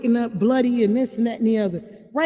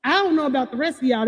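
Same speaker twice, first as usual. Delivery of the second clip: ordinary delivery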